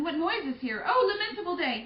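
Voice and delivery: high, loud stricken cry; lamenting mother. Acted. Lady Capulet finding her daughter dead, wailing. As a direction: cry out in grief.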